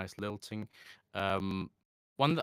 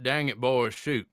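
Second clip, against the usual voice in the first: louder, punchy Southern US accent; Southern American accent; 3 semitones higher, 7 dB louder than usual. Shown as a demonstration. Showing the phrase for getting into it, Southern American accent. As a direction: kick into the accent with a Southern exclamation. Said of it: "is basically the best phrase".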